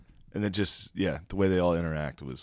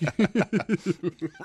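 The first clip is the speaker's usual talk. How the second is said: sharp, high burst of laughter; real laugh, high and loud; 12 semitones higher, 3 dB louder than usual. Real, not acted. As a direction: crack up at the joke. Laughing at the joke.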